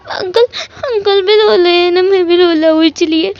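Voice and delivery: put-on high, tearful toddler voice with a lisp; little kid sobbing his questions; crying little boy. Small child cries and asks his uncle why he is crying.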